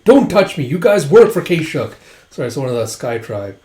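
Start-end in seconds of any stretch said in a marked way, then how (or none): 0.0-1.9 loud, high rebuff; retold indignant outburst; acted angry protest. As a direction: snap at unwanted helpers. Wounded man rejects helpers.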